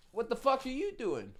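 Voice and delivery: put-on high voice of a heckler; imitated stranger. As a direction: call it out high like an accusing stranger. Imitating the stranger who startled him.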